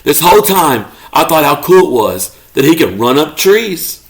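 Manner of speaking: ordinary delivery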